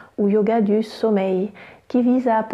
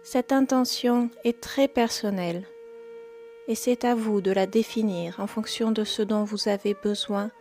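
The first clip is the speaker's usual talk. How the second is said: guided meditation; 9 dB quieter than usual. Acted. Yoga teacher guiding listeners into yoga nidra, calm meditation voice.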